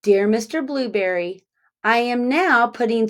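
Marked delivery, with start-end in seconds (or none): none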